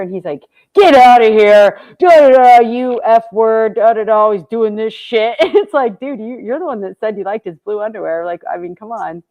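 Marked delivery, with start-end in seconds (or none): 0.7-5.4 loud, slow, mock-angry outburst; imitating Billy yelling at his friend, louder and slower; angry retort. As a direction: bark it out like a fed-up friend.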